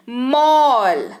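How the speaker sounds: मॉल drawn out slowly with a long "aw"; pronunciation demo. Shown as a demonstration. Teacher answering own question for learners, slow pronunciation demo of मॉल. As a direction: stretch मॉल out as "mawl". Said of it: "कैसे उच्चारण कर रहे हैं?".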